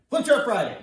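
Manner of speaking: loud, high-pitched show-title announcement; big hammy game-show shout. Pretend. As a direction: belt out the segment name.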